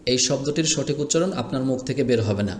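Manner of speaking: ordinary talk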